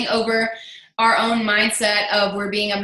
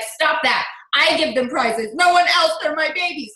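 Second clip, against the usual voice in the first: mock freak-out; 6 semitones higher than usual. Retelling how she yelled at a teammate over prizes, a playful mock freak-out. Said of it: "like, freak out".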